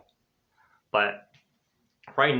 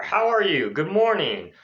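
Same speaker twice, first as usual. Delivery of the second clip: high, lilting welcome; cheery greeting; 6 semitones higher than usual. Shown as a demonstration. Teacher modelling an intro, cheery greeting. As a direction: lift the pitch like a keen greeter.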